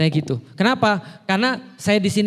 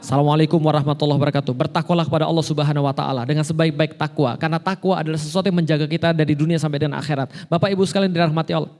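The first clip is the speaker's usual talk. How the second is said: low, fast formal khutbah delivery; Friday sermon voice; 4 semitones lower, faster than usual. Shown as a demonstration. Preacher showing the proper khotib role, low and formal. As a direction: intone it like a khotib at the mimbar. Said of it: "perannya sebagai khotib Jumat".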